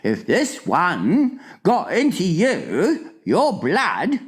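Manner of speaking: high, slow, dramatic warning; alarmed giant. A narrator's reading. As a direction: draw out the horrors to scare. Giant warns Sophie.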